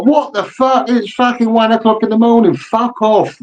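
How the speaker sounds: high-pitched indignant mock outburst; acted high, exasperated rant; outraged callee. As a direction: play someone furious at being woken. Woken callee snaps at prank caller.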